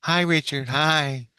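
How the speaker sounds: put-on high-pitched girl greeting; girl in his head. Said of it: "She goes".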